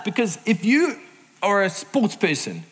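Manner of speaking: ordinary delivery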